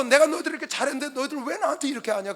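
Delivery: high-pitched reproachful appeal; preacher voicing an aggrieved God; God remonstrating. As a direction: plead like one wronged by their own people. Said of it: "하나님께서".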